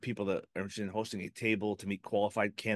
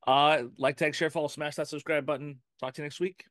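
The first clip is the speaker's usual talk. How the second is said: energetic sign-off; 7 semitones higher, 4 dB louder than usual. Real, not acted. Energetic sign-off call to subscribe.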